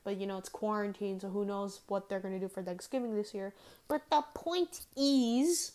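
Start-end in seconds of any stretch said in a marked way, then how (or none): 3.9-5.7 drawn-out emphasis. Real, not acted. Repeats the same setup.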